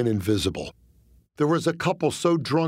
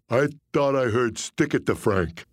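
dim hulking goon. Acted. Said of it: "in broken human".

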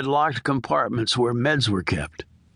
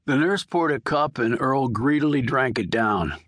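usual speaking voice

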